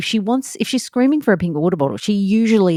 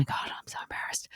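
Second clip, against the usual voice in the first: much softer whispered apology; embarrassed whisper; 17 dB quieter than usual. Retold or imitated. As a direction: hush it, mortified in front of strangers. Embarrassed mum to strangers on stairs.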